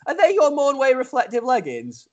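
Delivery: a hint of Matt's puzzled question; quoting Matt. Retelling Matt's question about her leggings, lightly voiced. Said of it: "what he actually said was".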